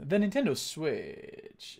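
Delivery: slow tech-reviewer voice, lingering on last syllables with vocal fry; Austin Evans impression. Streamer imitating a tech YouTuber's review voice, slow and fried. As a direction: stretch each phrase end into a fry. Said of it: "he talks a little bit like that".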